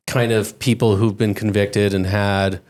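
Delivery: ordinary talk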